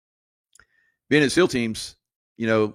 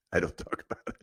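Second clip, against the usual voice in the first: unvoiced giggling aside; hushed laugh; laughing whisper; 4 semitones lower, 10 dB quieter than usual. Real, not acted.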